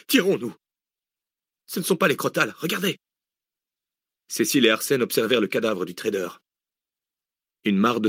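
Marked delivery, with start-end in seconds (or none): 0.1-3.0 panicked. A narrator's reading. Panicked exchange over the body.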